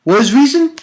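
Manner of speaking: loud, high rhetorical question; scornful question. Real, not acted. Reviewer challenging the villain's stupid goals, scornful question.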